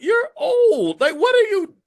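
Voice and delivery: put-on high, loud disbelief at an old man's threats; incredulous. Host retelling his reply to a threatening caller, high incredulous squeal. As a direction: squeal it like you can't believe it.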